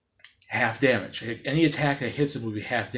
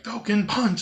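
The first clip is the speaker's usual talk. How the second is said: higher, lightly mock battle cry; Falcon Punch shout; 9 semitones higher, slower than usual. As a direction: toss it off like a hero's signature move. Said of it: "instead of him saying".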